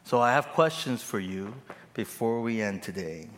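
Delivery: ordinary delivery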